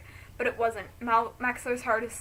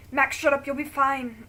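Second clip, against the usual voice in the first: ordinary delivery, nothing marked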